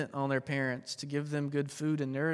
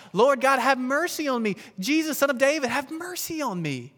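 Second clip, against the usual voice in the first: higher, louder plea, slowing at the end; shouting for mercy; 7 semitones higher, 6 dB louder than usual. Retold or imitated. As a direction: call out desperately to Jesus. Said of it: "he shouts and cries out".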